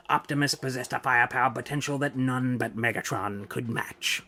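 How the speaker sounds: usual speaking voice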